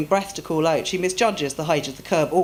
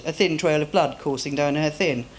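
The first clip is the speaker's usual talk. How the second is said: usual speaking voice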